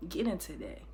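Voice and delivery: ordinary talk, nothing marked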